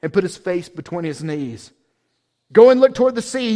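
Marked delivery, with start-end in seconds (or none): 2.5-3.5 loud, fast order to the servant; urgent command. Acted. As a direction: send him running. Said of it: "he told his servant".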